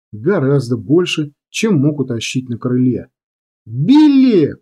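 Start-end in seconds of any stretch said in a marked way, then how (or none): 3.7-4.6 high-pitched cry of a name; anguished scream. A narrator's reading. Comrade seeing bomber go down, anguished cry. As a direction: cry out after a falling comrade.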